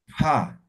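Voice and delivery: breathy aspirated ja, like blowing; aspirated sound. Shown as a demonstration. Teacher demonstrating aspiration, blowing out on ja. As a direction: blow the air out on ja. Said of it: "como soplando".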